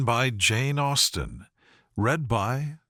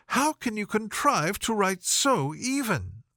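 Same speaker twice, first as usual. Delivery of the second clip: Miss Bingley admiring; 7 semitones higher than usual. A narrator's reading. Miss Bingley admiring Darcy's writing, cooing and high. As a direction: coo the compliment at him.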